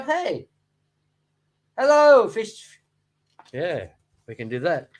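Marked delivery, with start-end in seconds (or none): 0.0-2.8 high, loud sing-song greeting at the glass; mock hello to the fish. A hobbyist teasing fish through the glass, high and loud mock hello.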